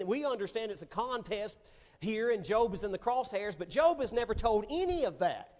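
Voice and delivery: usual speaking voice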